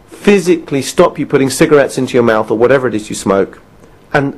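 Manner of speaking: ordinary delivery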